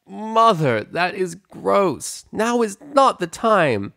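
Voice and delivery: high, slow mortified daughter voice; embarrassed protest. Acted. Pepper protests her mother's kissing, high slow mortified. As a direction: drag out the disgust.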